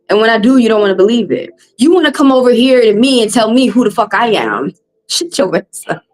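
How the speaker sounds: ordinary talk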